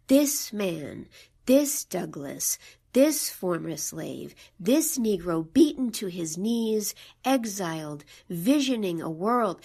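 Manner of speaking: reading the lines to show their assertive beat; assertive poem reading. Acted. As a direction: lean into every this like a chant. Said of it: "You can hear how assertive that is".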